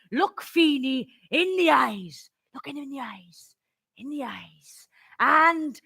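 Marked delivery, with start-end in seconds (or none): 2.5-3.6 hushed repeat, much softer; fading echo. Acted. Granddad's advice echoing in memory, hushed fading echo. 4.0-4.9 very soft, low repeat; fading echo. Acted. Last echo of granddad's advice, fading hush.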